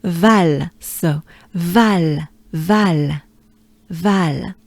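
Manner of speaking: val with an open French a. Shown as a demonstration. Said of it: "merged in".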